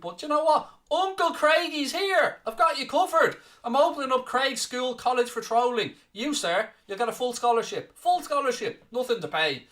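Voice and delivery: bigging himself up as the jolly uncle; hammed-up Uncle Craigie.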